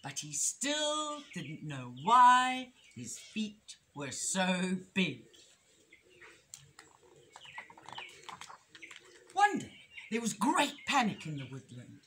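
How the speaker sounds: lilting, emphatic picture-book reading; story reading to children. A narrator's reading. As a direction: read it expressively to little listeners.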